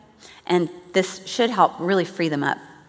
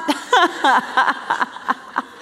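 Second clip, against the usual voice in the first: laugh; 7 semitones higher than usual. Real, not acted. Delighted by the wife's phrase.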